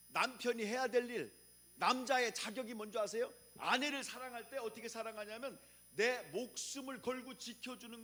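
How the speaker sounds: high, loud emphatic preaching; fiery preaching. Acted. Preacher exhorting husbands, high loud fiery preaching. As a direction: pound each phrase home.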